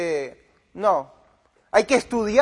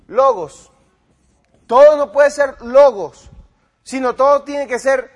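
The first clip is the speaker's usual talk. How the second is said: loud mocking echo; 4 semitones higher, 8 dB louder than usual. Mocking the pastor's claim.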